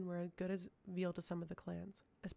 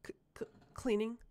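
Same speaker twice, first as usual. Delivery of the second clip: breathless, whispered stutter, higher and louder; faked frightened stammer; 4 semitones higher, 5 dB louder than usual. A narrator's reading. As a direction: stammer it out as if terrified, too perfectly. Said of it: "the girl stammered, too brokenly, too perfectly".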